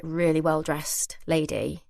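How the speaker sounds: normal talking voice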